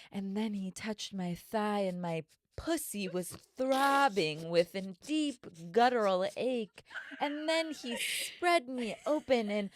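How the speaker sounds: breathy erotic audiobook read, rising to a high moan; mock smut narrator. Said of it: "narrator being like".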